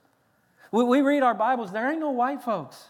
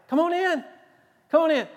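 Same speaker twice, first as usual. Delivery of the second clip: higher, louder welcoming call; calling out an invitation; 8 semitones higher, 3 dB louder, faster than usual. Acted. Jesus inviting weird people in, calling out. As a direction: call them in, pitched up high.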